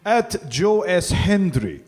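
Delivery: slow, high, mock Russian accent plug. Wrestler giving his Twitter plug on request in a mock Russian accent. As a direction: stretch out the handle in a hammed-up Russian accent. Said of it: "so give me your plugs in Russian".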